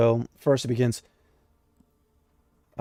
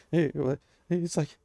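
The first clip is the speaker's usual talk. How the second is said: usual speaking voice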